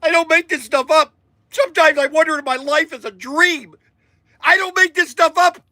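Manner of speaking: high, worked-up exclamation; emotional outburst. Real, not acted. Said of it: "I shouldn't get so emotional".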